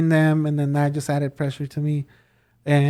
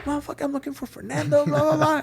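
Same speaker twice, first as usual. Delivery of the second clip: much higher, imitating a screaming customer; mock yelling; 11 semitones higher than usual. Customer yelling at the manager at the counter, mock yelling. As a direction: yell it like the guy at the counter, hammed up. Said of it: "starts yelling, screaming".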